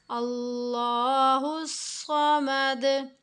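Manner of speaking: slow, drawn-out chanted recitation; slow Quran recitation. Shown as a demonstration. Quran teacher demonstrating a verse, slow chanted recitation. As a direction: recite it slowly, drawing out each vowel. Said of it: "repeat कर लेते हैं".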